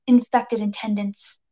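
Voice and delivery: plain reading voice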